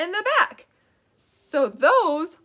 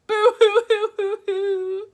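hammed-up boo hoo sobbing, higher; mock crying; 5 semitones higher than usual. Shown as a demonstration. Teacher showing kids crying as opposite of laughing, mock sobs. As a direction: fake a big silly cry. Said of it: "And now cry".

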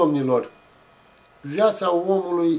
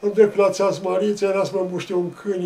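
ordinary talk, nothing marked

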